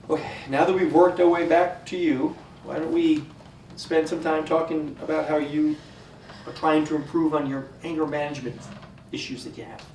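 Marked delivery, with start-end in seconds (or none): none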